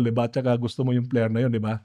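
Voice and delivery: ordinary talk, nothing marked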